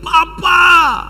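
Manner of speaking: high, loud cry to father; a child's loud, high call; shouted cry. Acted. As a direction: shout it out, drawn long. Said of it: "dia berteriak".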